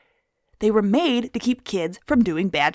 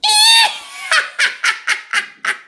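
high, loud evil witch laugh; witch cackle; 4 dB louder than usual. Acted. Witch cackle after "I'm a witch.

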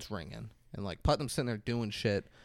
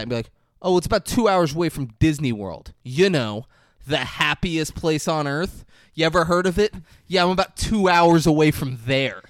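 higher, louder hammed-up condescension; mock snobby exchange; 5 semitones higher, 8 dB louder than usual. Host parodying the agent's snobbery with co-host as example, loud mocking voices. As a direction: play both sides of a smug small-talk exchange. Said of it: "who the fuck talks like that".

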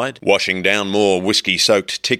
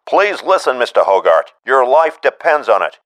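urgent plea; 4 semitones higher, 5 dB louder than usual. A narrator's reading. Staines pleading urgently.

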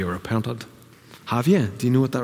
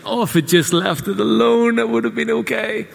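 whiny self; 8 semitones higher than usual. Retold or imitated. Mock self-talk quote.

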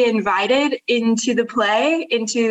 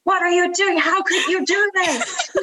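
high mock-accusing spirit voice; the creative spirit scolding her, pitched high; 7 semitones higher than usual. Spirit of creativity scolding the artist, mock outrage.

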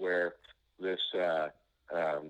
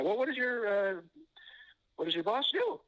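incredulous; 12 semitones higher than usual. Retold or imitated. Retold incredulous question to customer.

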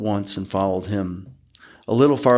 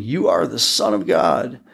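higher-pitched demon cry, not full volume; mild narrated shout; 6 semitones higher than usual. A narrator's reading.

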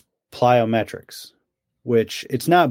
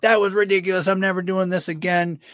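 higher mock whiny complaint; imitating the Jackal; 5 semitones higher than usual. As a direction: whine like a runner swearing off marathons. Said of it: "He's like".

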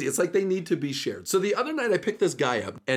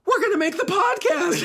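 mock frantic yelling. Jacob yells to his friends in the back, frantic and thrilled. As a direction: shout it half scared, half thrilled. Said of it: "So he's yelling".

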